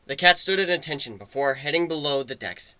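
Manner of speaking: normal talking voice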